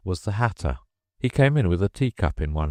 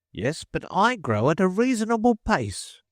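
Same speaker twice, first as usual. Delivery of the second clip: high indignant dormouse; 14 semitones higher than usual. Acted.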